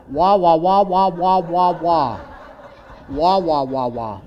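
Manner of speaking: laughing trombone wah-wah; loud wah-wah drone. Pretend. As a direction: drown out God's voice with wah wahs. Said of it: "Just like Charlie Brown's teacher".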